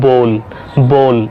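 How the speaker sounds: "bowl" with long "o", like bole; correct pronunciation. Shown as a demonstration. Teacher gives the correct word, "bowl" with a long "o". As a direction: say "bowl" as BOHL. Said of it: "Correct pronunciation is".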